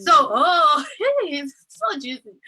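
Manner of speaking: can't wait to spill the tea; excited gossip. Real, not acted. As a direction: bubble over with juicy news, laughing. Excited start of a gossip story.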